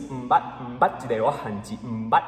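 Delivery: "Mbat" ending in a held, unreleased T; Taiwanese stop. Shown as a demonstration. Teacher comparing Taiwanese to English for the class. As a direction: clip the final T like in Taiwanese. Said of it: "Kind of like in Taiwanese".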